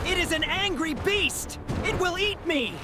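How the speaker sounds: panicked. Acted. Panicking at noise.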